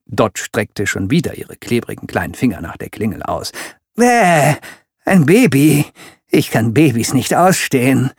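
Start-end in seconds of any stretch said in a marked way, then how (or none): 3.9-8.1 loud disgusted villain bluster. Acted. Villain seeing a baby at the door, loud disgusted blustering. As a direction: spit out the disgust, then rumble on. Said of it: "polterte Ellen".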